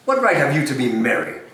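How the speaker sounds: usual speaking voice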